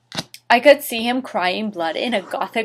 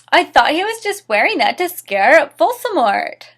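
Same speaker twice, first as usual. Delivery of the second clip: mock indignation; 7 semitones higher, 5 dB louder than usual. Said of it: "I shouted angrily".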